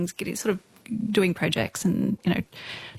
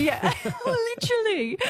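laughing; 12 semitones higher than usual. Real, not acted. Cracking up at the joke.

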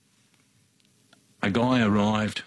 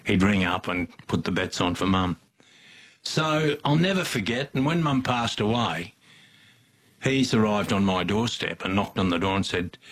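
normal talking voice

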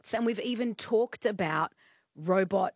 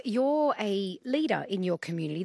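usual speaking voice

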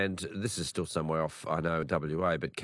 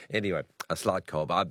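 normal talking voice

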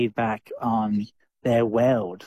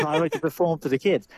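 lightly imitated drama teacher, quick delivery; a hint of a showy teacher, said fast; Mister G; 3 semitones higher, faster than usual. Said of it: "sound like a Mister G here".

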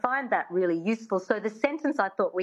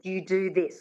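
ordinary talk

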